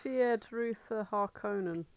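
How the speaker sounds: normal talking voice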